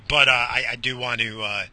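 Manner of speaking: ordinary talk, nothing marked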